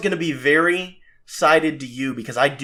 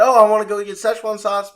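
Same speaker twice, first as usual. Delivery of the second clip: higher, louder mock eagerness; imagined eager listener; 6 semitones higher, 5 dB louder than usual. Host voicing listeners who just heard the news, mock eager. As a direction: mimic an eager fan, pitched up. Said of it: "you're like".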